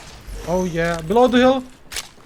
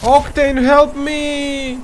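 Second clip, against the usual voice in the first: calling for help; 8 semitones higher, much slower than usual. Real, not acted. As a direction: yell to a teammate.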